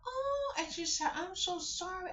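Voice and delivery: put-on high little-girl apology; sorry like a small girl; high sheepish child voice; child apologizing.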